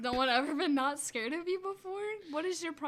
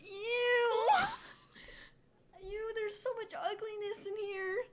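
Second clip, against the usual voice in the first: disgusted ew; 7 semitones higher, slower than usual. Acted. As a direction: recoil in disgust. Disgusted at Snoke.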